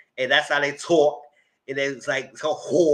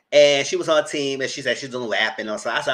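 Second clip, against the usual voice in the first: ordinary talk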